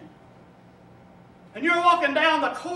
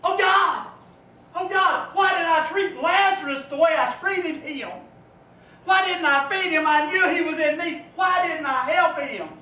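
high, loud anguished pleading; rich man crying out; 4 semitones higher, 4 dB louder than usual. Acted. Preacher voicing the rich man in torment, anguished crying out. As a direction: shout your regret up to God. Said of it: "And he's saying".

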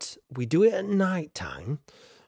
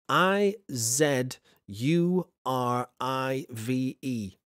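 ordinary talk

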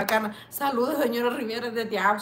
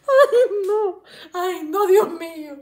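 laughing; 7 semitones higher, 8 dB louder, slower than usual. Real, not acted. Helpless laughter at comment.

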